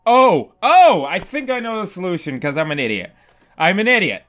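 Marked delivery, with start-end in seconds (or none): none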